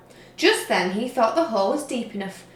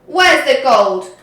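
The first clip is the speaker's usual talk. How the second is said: loud, slow demanding shout; 3 semitones higher, 11 dB louder, slower than usual. A narrator's reading. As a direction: bellow it at the troll. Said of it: "shouted Hank Chief".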